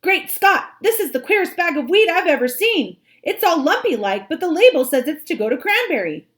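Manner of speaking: loud, high astonished shout; sailor yelling. A narrator's reading. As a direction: bellow it like a startled sailor. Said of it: "one sailor yelled".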